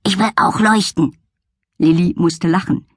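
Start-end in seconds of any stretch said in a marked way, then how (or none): 0.0-1.2 higher, eager puppy voice; yapping little dog. Acted. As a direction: yap it out eagerly. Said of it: "tapste er näher und kläffte".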